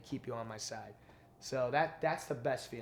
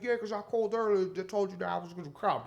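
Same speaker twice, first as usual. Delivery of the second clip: much higher, louder, different voice; Tracy Morgan impression; 7 semitones higher, 4 dB louder than usual. Comic imitating the star thanking the host, high and loud. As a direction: do a bad celebrity voice on purpose. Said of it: "Tracy Morgan impression".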